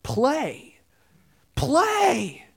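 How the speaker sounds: put-on high little-kid voice, drawn out and insistent; a small child begging to keep playing; toddler voice. Toddler to his dad, pleading to pray, high childlike voice. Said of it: "And he says".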